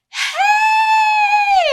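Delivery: high, loud, delighted hey; imitating a stranger's excited greeting; excited shout imitated. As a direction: shout hello like an overjoyed fan. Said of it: "responding in the same manner".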